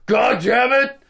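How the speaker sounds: high, loud Sloth-like curse; Brett the janitor. Retold or imitated. Janitor cursing at kids who unplug his vacuum, mock-furious holler. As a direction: imitate an exasperated Sloth hollering. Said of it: "kinda sounded like Sloth from Goonies".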